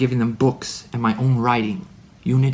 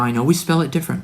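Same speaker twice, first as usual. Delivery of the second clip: ordinary delivery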